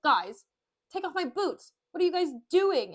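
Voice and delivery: mild exasperated yell; half-hearted hollering at careless staff; mock yelling. Petruchio complaining to servants about his boots, mildly yelling. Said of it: "yelling at his servants".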